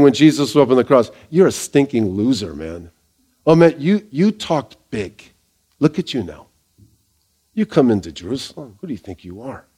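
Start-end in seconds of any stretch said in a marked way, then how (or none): 1.3-6.5 low, soft, dismissive put-down; quietly scornful, trailing away; mocking. Onlookers belittling Jesus on the cross, mocking. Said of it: "And they're mocking him". 7.5-9.6 low, hushed scornful challenge; mocking. Scoffers challenging Jesus on the cross, mocking. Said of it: "And they're mocking him".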